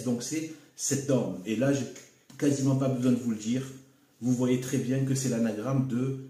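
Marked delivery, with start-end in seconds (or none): 0.8-1.4 "Sedom" starting with a clear s sound; C pronounced S. Shown as a demonstration. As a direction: say "Sedom" as "sèd-DOM", opening on s. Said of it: "il se prononce S". (« Sedom » avec un s initial, « sèd-dom »)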